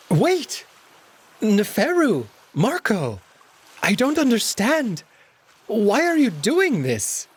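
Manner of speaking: high, slow pleading; fake-desperate plea. Alex pleading with both of them, high and desperate. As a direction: overplay the helplessness.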